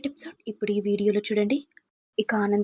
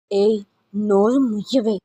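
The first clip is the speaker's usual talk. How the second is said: louder, slow, contemptuous order to shut up; angry silencing; 5 dB louder than usual. A narrator's reading. Mother-in-law silencing her daughter-in-law, slow angry order. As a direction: bark it down slowly.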